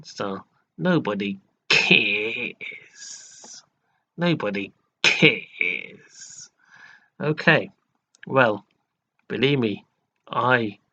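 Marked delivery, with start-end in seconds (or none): 0.8-6.5 put-on very slow, drawn-out "Nobody cares" in a posh American accent; mock American posh accent. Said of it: "American sort of accent style".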